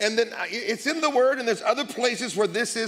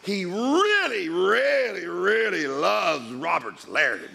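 slow drawn-out emphasis; much slower than usual. Real, not acted. Preacher stretching a boast.

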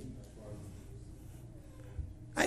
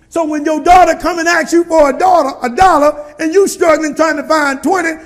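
higher, louder preacher build; full-force preaching; 5 semitones higher, 9 dB louder than usual. Acted. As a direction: preach it to the congregation.

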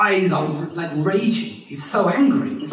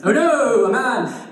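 high, loud mock cry of alarm; mock stage actor crying out with a laugh; hammed-up melodrama; 8 semitones higher, 5 dB louder, slower than usual. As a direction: overact a stage fright. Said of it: "mock melodrama".

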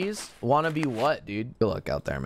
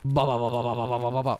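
rapid lower "ba" burst; imitated gunfire; 5 semitones lower, faster than usual. Streamer showing teammates how the fight went, mouthed gunfire. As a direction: mouth out machine-gun fire. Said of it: "and I went".

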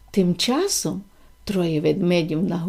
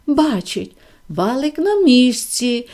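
ordinary delivery, nothing marked

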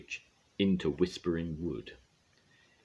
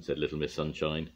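ordinary delivery

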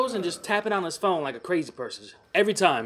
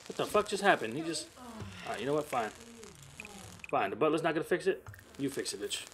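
ordinary delivery

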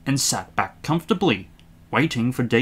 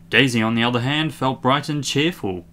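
plain reading voice

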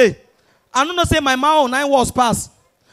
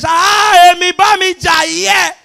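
loud, high, drawn-out fighting cry; mock challenge shout; 4 semitones higher, 5 dB louder, much slower than usual. Yoruba boy shouting at his opponent in a fight, mock yell. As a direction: stretch out a hammed-up yell. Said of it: "Yoruba boy say".

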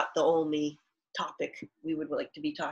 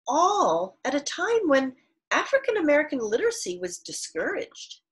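louder, clear read-aloud for the class; reading aloud to children; 3 semitones higher, 4 dB louder than usual. Acted. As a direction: read the author's words to students. Teacher reads author's note aloud.

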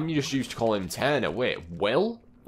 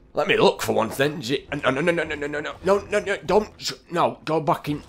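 high-pitched rush of pleading noes; urgent, rising stream of objection; alarmed protest; 6 semitones higher, 5 dB louder than usual. Real, not acted. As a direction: climb higher as you protest. Game goes against what he wants.

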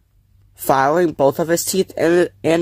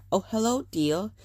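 sighing; 8 semitones higher, 7 dB quieter than usual. A narrator's reading. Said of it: "his mother sighed".

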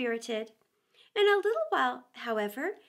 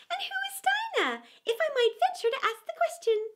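very high, quick prim bird; a nosy parrot asking; lory voice; 13 semitones higher, faster than usual. Acted.

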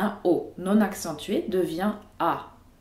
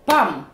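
single hard, loud PAM, like a hammer blow; stressed beat; 7 dB louder than usual. Shown as a demonstration. Teacher showing Russian word rhythm, stressed beat. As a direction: hit "Pam" as one strong stressed beat. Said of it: "l'accent tonique par un coup de marteau".

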